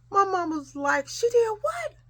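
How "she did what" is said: high, rising disbelief; acted shocked mother. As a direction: ask it as an outraged parent, reenacted.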